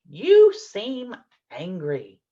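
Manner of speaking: slow, gently questioning "You seem angry.". Shown as a demonstration. Teacher shows parents how to name a feeling, slow and questioning. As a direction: ask "You seem angry." slowly, letting it hang as a question. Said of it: "Use a questioning tone".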